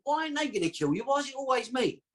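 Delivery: why always me, hurt; aggrieved protest. Retold or imitated. Dying father accuses him.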